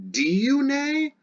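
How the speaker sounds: very high asking pitch on Diune; questioning lilt. Shown as a demonstration. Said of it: "was it for a long time".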